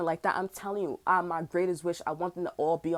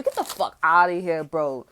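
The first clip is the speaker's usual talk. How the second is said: lightly mimicked man, louder attack; imitating his voice; 4 semitones lower, 5 dB louder, slower than usual. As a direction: mimic his dismissal, louder then dropping low. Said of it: "That's how he sound too".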